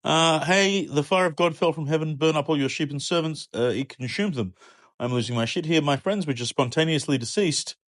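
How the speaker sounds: high-pitched frantic report; losing it while delivering the news; panicked messenger. Acted. Second messenger frantically telling Job his sheep burned.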